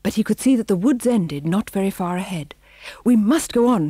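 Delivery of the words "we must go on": raised, loud urging; high, urgent cry. A narrator's reading. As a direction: urge the troop onward. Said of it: "he cried".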